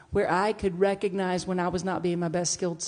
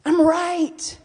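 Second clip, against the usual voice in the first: mock insistent; 10 semitones higher, 9 dB louder than usual.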